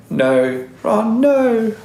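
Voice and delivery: quoted line, pitch leaping up on Ron, no; playful Australian-tinged quote; mock Australian. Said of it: "we sound Australian when we do it".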